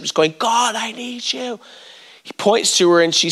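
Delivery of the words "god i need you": high, slow, softened imitation of a tearful worshipper; mock weeping plea. Weeping lady in the front row pleading to God, mock tearful wail. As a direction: plead slowly, voice high and weepy. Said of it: "weeping all through worship".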